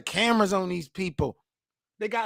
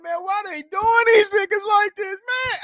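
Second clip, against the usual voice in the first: put-on crying little boy; 12 semitones higher than usual. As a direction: shout it like a kid upset at the TV. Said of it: "And I'm like".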